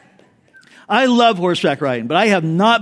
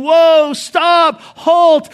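high, loud panicked shouts; frantic cries to stop the horse; panicked yelling; 10 semitones higher, 6 dB louder, much slower than usual. Retold or imitated. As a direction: yell at a runaway horse in panic. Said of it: "And then he panics".

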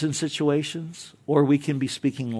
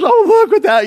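high, loud gushing parent; excited doting parent; 10 dB louder than usual. Retold or imitated. Parent filming child in school play, excited high gush. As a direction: gush over the kid on stage.

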